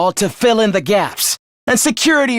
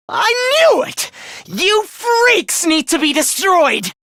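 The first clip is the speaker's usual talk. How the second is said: high-pitched, raging cry; furious outburst; 10 semitones higher, slower than usual. Acted. Villain condemning the humans as freaks, furious outburst. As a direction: explode high, then stretch it out in fury.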